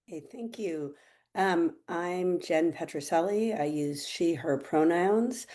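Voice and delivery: ordinary talk, nothing marked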